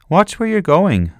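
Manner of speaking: dad calling out. Acted. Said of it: "said Dad".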